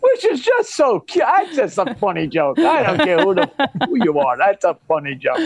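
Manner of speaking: talking through a laugh; giggling while praising the joke. Real, not acted. Still laughing after the punchline.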